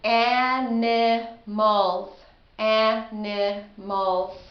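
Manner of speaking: very slow, Animals stretched beat by beat; slow syllable demo. Shown as a demonstration. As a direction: draw out A-ni-mals. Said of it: "From the sound search lesson".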